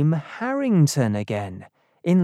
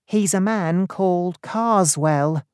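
higher-voiced librarian; helpful librarian; 4 semitones higher than usual. A narrator's reading. Librarian tells Dunning who the man is, higher-pitched helpful voice. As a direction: answer helpfully in a lifted voice. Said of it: "the librarian replied".

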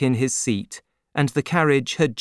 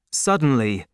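usual speaking voice

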